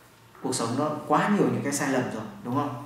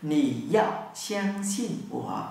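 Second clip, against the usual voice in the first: slow demo; much slower than usual. Shown as a demonstration.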